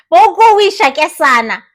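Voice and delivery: ordinary delivery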